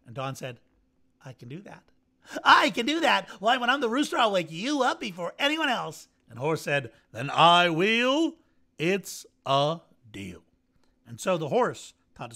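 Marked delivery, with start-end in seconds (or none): 1.2-1.8 much softer, lower, to himself; quiet murmur. Acted. Don thinking it over to himself, quiet. 2.4-6.1 much higher and louder, eager; excited outburst. Acted. Don promising Horse eagerly, high and loud. 7.1-10.4 slow, weighty and a bit louder; slow deal. Acted. Horse agreeing with Don, slow and firm.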